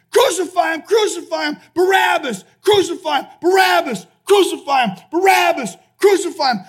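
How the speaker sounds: relentless high, loud mob chant; crowd shouting. Retold or imitated. Mob shouts heard from Barabbas' jail cell, loud chanting. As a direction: hurl alternating cries without a let-up. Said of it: "hearing the shouts".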